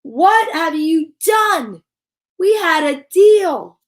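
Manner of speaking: slow, shouted, high scolding; furious mother scolding her son; angry mom. Acted. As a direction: let the anger land word by word. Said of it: "she screamed".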